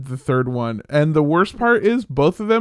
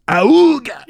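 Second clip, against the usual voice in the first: short, loud zombie grunt; zombie noise; 11 semitones higher, 6 dB louder than usual. Pretend. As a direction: groan it out like a goofy zombie. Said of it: "Zombie noise".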